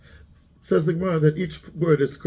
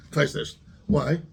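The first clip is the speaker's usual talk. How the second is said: usual speaking voice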